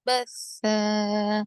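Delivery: slow, drawn-out tajweed chant; Quranic recitation. Shown as a demonstration. Tajweed teacher reciting for students, slow measured chant. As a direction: recite the verse slowly with elongated vowels.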